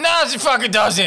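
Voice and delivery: high, loud mock outburst; hammed-up yelled denial; blurted refusal. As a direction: burst out with an indignant no. Imagined blurted refusal in story.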